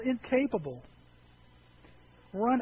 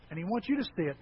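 usual speaking voice

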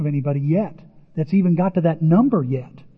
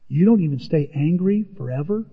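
ordinary delivery

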